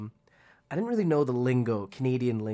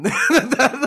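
usual speaking voice

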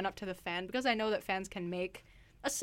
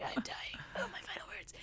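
faint whispered last words; dying voice; 12 semitones higher, 8 dB quieter than usual. Retold or imitated. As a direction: breathe it out like a dying hero. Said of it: "sort of like a dying voice".

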